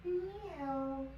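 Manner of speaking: high mock cat meow; playful meow. Yoga teacher cueing cat pose for the class, playful meow.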